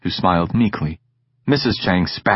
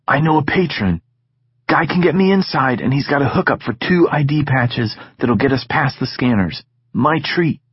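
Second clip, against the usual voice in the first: put-on lightly higher, teasing young friend; Egan's voice, cocky; 6 semitones higher than usual. Egan needling Sid about school, teasing. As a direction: tease your friend about his tone.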